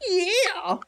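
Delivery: squawky rooster yeah; Ruby's voice. Acted. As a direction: play a melodramatic rooster. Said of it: "I'll do a voice for Ruby".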